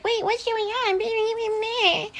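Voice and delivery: put-on very high, drawn-out child voice; whining kids. Kids interrupting the witch's reading of their dad, high whine. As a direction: squeal it slowly like confused kids. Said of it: "his kids are like".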